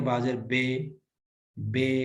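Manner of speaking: بے said with an open eh vowel; wrong be. Shown as a demonstration. Teacher showing students the wrong بے. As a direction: say بے as a flat bay sound. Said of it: "بے بڑی یا کے ساتھ پڑھنا غلط ہے".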